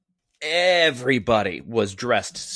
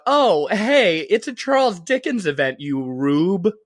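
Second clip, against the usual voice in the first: high, loud self-mocking jab; mock epiphany; 6 semitones higher, 4 dB louder than usual. Host mocks themself for missing the Dickens connection, high mock epiphany. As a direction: blurt out a hammed-up late realization. Mocks own late realization.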